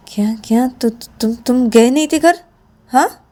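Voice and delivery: stammering high-pitched shock, a whisper at first; shocked disbelief. Acted. Shreya stunned that Shruti never went home. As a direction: gasp out the questions, voice climbing.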